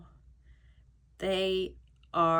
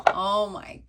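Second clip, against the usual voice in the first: ordinary delivery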